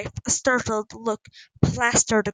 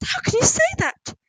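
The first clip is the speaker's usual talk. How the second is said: very high-pitched shocked question; aghast; 3 dB louder than usual. A narrator's reading. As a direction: squeak out hurt disbelief. Mother aghast at daughter.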